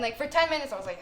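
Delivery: normal talking voice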